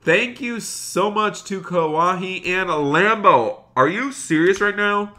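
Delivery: high, drawn-out, thrilled gratitude; excited thanks. Real, not acted. Streamer reacting to a donation, excited and high. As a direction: gush with amazement, pitched well up.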